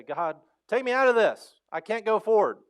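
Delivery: loud, high desperate plea; complaining to God. Retold or imitated. Believer complaining to God, loud and high. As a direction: cry out to be rescued. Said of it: "complain to God and say".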